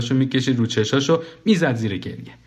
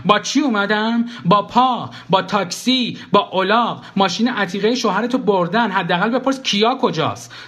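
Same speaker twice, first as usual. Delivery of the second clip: high, exasperated retort; high-pitched sarcastic protest; exasperated sarcasm; 9 semitones higher than usual. Acted. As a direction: list absurd answers in exasperation. Acted sarcastic retort in quarrel.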